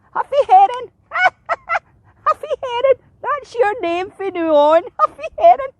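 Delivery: high, loud, teasing gull voice; mock him with shrieking seagull glee; screeching seagull. A narrator's reading. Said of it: "And she screeched".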